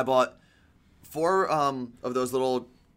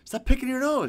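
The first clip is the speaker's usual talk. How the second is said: mock scolding; 12 semitones higher, 3 dB louder than usual. Real, not acted. Teasing scolding, high pitch.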